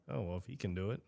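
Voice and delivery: very soft, low imagined reasoning; imitating other people. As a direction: drop right down for a quiet quoted thought. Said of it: "and they're like".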